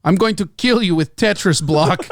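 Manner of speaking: comic Russian threat; Russian accent. Acted. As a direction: show off in a mock Russian accent. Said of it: "you can do a Russian accent".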